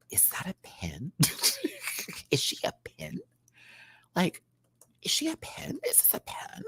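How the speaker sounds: unvoiced whisper of puzzled guessing; whispering to self. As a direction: whisper your guesses to yourself. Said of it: "I kept saying to myself".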